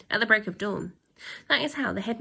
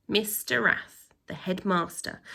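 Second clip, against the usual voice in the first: ordinary delivery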